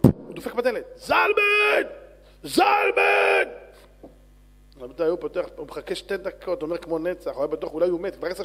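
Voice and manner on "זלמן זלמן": long, high, loud holler; drawn-out full-voiced call. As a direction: shout the name through a closed door. Calling out at Zalman's door.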